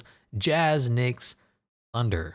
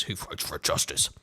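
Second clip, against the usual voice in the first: mock heroic aside; 7 semitones lower, 5 dB quieter than usual. Real, not acted. Joke about name Derek Justice.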